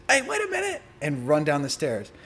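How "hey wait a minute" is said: much higher cop's call to stop. Retold or imitated. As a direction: yell it like a cop chasing you. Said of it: "the cops that were waiting outside say".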